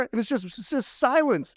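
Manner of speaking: usual speaking voice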